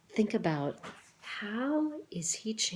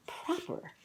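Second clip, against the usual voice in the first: whisper; 12 semitones higher, 6 dB quieter than usual. Shown as a demonstration. Repeating a word from story.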